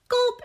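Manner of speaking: a sudden high, loud cartoon gulp; mock gulp. Host reacting to the ninety-five dollar price, with a mock high gulp. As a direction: gulp like the price scared you.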